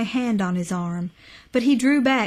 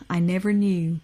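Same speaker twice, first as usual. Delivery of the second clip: usual speaking voice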